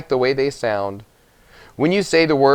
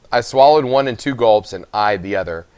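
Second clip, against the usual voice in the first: plain reading voice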